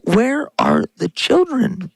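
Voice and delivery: usual speaking voice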